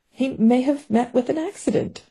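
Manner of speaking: a hint of a sheepish retreat, MAY lifted high and trailing off; lame amendment. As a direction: offer "He may have met with an accident" weakly, backing down. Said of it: "she amended lamely".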